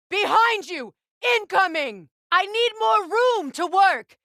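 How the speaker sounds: high, loud alarm calls; battle warnings shouted. Acted. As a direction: warn your ally over the noise of the fight. Combat warnings during fight.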